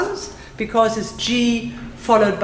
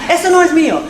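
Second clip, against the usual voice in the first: high, louder quick-fire Spanish; said fast; 7 semitones higher, 6 dB louder, much faster than usual. Shown as a demonstration. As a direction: rattle it off boldly. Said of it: "Say it fast".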